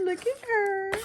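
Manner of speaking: jumps way up in pitch, gushing; high delighted gush; excited squeal. Real, not acted. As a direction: squeal over the cute tags. Gushing over the tags.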